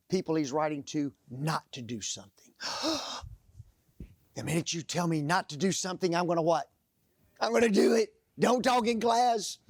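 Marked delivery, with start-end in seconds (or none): 2.6-3.3 mock gasp. Mock shock at being told no. 7.4-8.1 defiant. Acted. Defiant punchline to own question. 8.4-9.6 stern teacher. Retold or imitated. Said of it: "quoting a teacher's rule".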